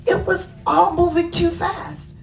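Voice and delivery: high flustered narration. A narrator's reading. Storyteller tells of too-fast ball game, high flustered voice.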